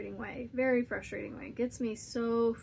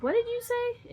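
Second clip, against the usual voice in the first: startled question; 12 semitones higher, 4 dB louder than usual. Retold or imitated. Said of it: "I said".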